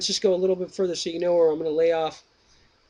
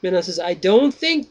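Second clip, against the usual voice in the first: slow, raised reading voice for the dialogue; dramatic reading; 4 semitones higher, 4 dB louder, slower than usual. Acted. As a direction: read the old text out slowly and grandly. Reads Plato dialogue aloud.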